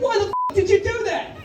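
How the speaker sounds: high, stunned why-did-you cry; mock outrage pitched high up; outraged frog. Drowning frog yells at scorpion, high mock outrage.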